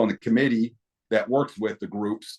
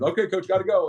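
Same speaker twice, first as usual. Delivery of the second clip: higher-pitched retold sign-off; hurried brush-off; 9 semitones higher than usual. Official leaving a coach for live TV, hurried. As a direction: break off as if needed elsewhere.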